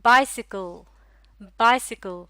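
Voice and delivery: "Bicycle" loud on the first syllable, falling away, then repeated; careful model word. Shown as a demonstration. Teacher asking learners to listen closely, careful model word. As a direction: punch the start of "bicycle" for listeners. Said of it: "गौर से सुनिएगा".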